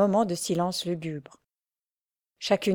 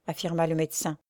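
usual speaking voice

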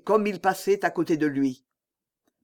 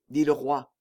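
ordinary delivery, nothing marked

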